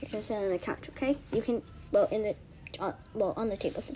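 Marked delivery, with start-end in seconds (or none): none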